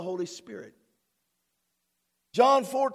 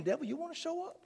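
taunting the devil; 4 semitones higher, 13 dB quieter, faster than usual. Pretend. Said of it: "It's like I'm saying".

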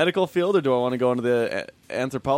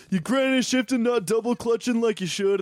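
Toretto impression; 9 semitones higher than usual. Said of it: "Toretto's like".

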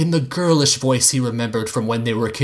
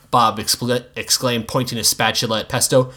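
plain reading voice

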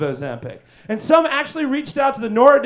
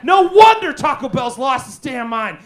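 ordinary delivery

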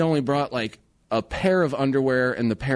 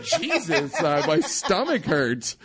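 laughing whine; 4 semitones higher, slower than usual. Retold or imitated. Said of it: "I was like".

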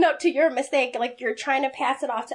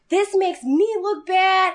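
raised, slow and louder, imitating the boss ranting; mock yell; 7 semitones higher, 3 dB louder, slower than usual. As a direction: stretch it out like a huffy boss complaining. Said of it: "he's yelling".

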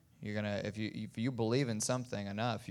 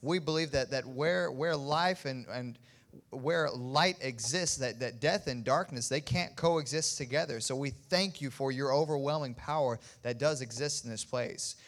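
rising, louder fervent prayer; preaching prayer; 3 semitones higher, 5 dB louder than usual. Acted. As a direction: pray with building fervour. Pastor leads closing prayer.

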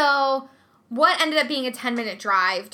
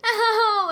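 high yelp, less forceful; pained cry; 10 semitones higher, 4 dB louder than usual. Retold or imitated. Mom retelling the sting of a bite, high pained cry. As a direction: let out an aching cry of hurt. Reenacting pain after the bite.

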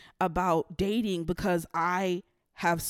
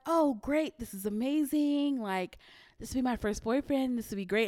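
retold giddy excitement, lighter and higher; giddy delight; 5 semitones higher than usual. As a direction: replay her teenage thrill, a bit hammed-up. Teen self hearing about boyfriend.

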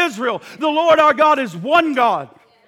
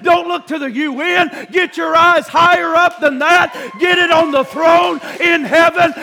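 loud, rising preacher's call; full-force preaching; 3 semitones higher, 5 dB louder than usual. Acted. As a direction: preach it out to the whole church.

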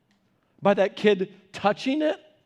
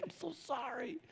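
very soft, higher-pitched sympathy; tender apology; 6 semitones higher, 18 dB quieter than usual. Retold or imitated. Parent to crying child, tender and sorry. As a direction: barely voice the apology, aching for them.

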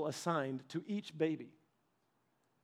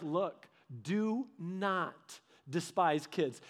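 very slow, softer, each word weighted; emphatic command; 3 semitones lower, 5 dB quieter, much slower than usual. Retold or imitated. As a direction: lean on "do not", slow and quiet.

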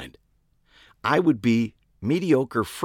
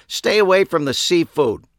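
loud, high stern repeat; loud warning; 9 semitones higher, 7 dB louder than usual. A narrator's reading. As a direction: hammer the warning home.

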